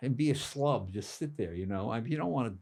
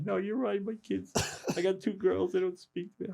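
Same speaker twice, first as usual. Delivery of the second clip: mild mock-tearful, higher-pitched voice; retold crying; 8 semitones higher than usual. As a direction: lightly imitate a man breaking down in tears. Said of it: "he starts crying".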